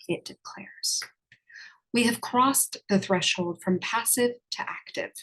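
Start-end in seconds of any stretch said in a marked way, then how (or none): 0.1-1.0 sultry narrator. Acted. Dramatic whispered aside.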